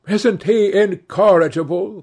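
high, loud exclamation; animated cry about a friend. A narrator's reading. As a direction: exclaim it high to the whole table. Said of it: "cried Dorian".

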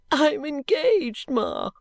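high, drawn-out tearful wail; acted sobbing daughter, high-pitched. A narrator's reading. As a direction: sob it out slowly to mother. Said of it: "sobbed Caddy".

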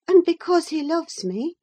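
put-on high-pitched young lady; high voice, hopeful of approval; Catherine. A narrator's reading. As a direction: offer the reason high, lifting at the end. Catherine answers the catechism.

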